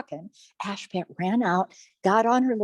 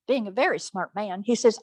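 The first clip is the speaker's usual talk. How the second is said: ordinary delivery, nothing marked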